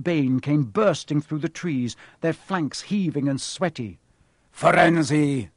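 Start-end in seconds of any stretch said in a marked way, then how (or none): 4.5-5.5 loud, booming angry shout of a name; thundering call. A narrator's reading. Angry centaur calls out his rival, loud thundering shout. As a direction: bellow the name in outrage. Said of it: "Bane thundered".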